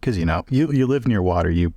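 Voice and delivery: normal talking voice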